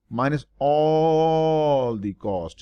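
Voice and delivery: "all" stretched slow and leaned on hard; stress on all. Shown as a demonstration. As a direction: drag out "all" so nobody misses it. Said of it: "Mind the word, I emphasize on the word all".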